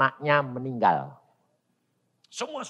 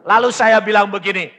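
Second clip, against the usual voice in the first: ordinary talk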